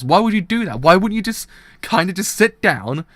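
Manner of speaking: loud, high indignant rant; shouting rant. Real, not acted. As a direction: shout it out in outrage. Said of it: "I shouted a bit too much".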